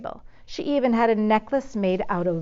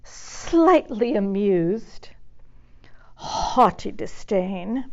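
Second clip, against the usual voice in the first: slow, breathy posing; haughty, languid whispered poses; affected whisper; much slower than usual. A narrator's reading. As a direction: whisper each pose slowly. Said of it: "fine-tuning some of her favorite expressions".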